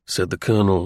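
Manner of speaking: ordinary delivery, nothing marked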